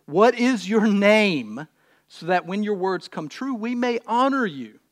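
projected, measured reading with weighted key words; scripture reading. Acted. Preacher reads Samson's birth story, scripture reading. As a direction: proclaim the Bible story to the room.

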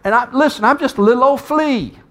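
high-pitched, pleading complaint; acted grievance. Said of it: "he's talking about what he's been through here".

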